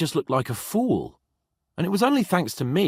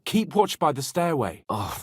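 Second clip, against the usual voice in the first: usual speaking voice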